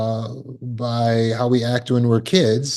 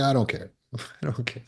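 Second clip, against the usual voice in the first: soft shrug; 8 dB quieter, faster than usual. Retold or imitated. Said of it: "I was like".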